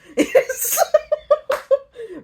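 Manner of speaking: squealing burst of laughter; high cackle at her own joke. Real, not acted. Teller cracking up at her retelling, laughing high.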